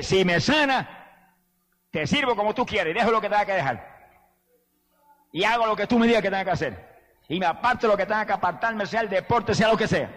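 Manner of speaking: pleading vow. Retold or imitated. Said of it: "una noche le dije".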